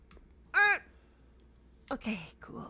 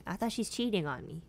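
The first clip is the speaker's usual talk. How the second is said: ordinary talk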